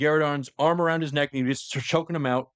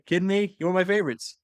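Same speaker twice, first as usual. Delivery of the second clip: higher-pitched, cajoling plea; coaxing; 6 semitones higher than usual. Retold or imitated. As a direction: coax a favorite back, pitched up. Horus coaxing Loken back.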